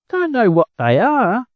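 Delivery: high, incredulous question; amazed chicken. Acted.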